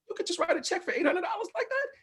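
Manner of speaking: high-pitched, laughing disbelief; incredulous reaction. Retold or imitated. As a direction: squeak it out in astonishment. Retold disbelief at generous offer.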